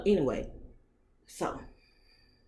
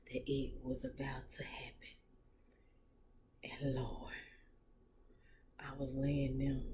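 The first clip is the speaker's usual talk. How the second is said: hushed telling; 3 semitones lower, 10 dB quieter than usual. Real, not acted. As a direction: drop to a whisper for the secret. Intimate story told hushed.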